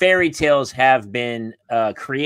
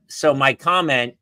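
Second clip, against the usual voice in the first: ordinary delivery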